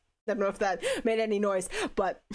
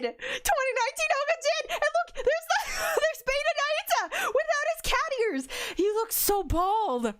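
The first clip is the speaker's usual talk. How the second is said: high, gushing delight at every reveal; excited fan squeal. Real, not acted. Fan seeing her favourite seiyu revealed, squealing with excitement. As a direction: shriek with fangirl glee.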